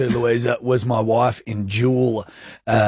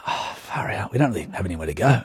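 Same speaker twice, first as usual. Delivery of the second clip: soft hushed quote; 5 semitones lower, 7 dB quieter than usual. Retold or imitated. New mates quoted.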